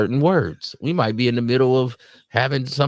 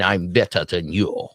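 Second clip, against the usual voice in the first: mock uppity air, pitched lower; imitated snootiness; 4 semitones lower than usual. Snob boasting over others, imitated snootiness. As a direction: look down your nose, imitating a snob. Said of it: "they start thinking they uppity".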